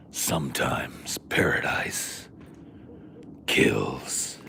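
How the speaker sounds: slow, low, whispered menace; creepy movie trailer narrator; horror trailer voice. Pretend. Horror movie tagline after.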